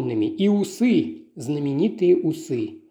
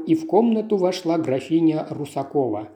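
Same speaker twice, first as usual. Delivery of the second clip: usual speaking voice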